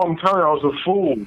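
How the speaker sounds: ordinary talk, nothing marked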